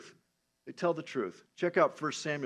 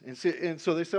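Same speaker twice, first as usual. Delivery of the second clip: usual speaking voice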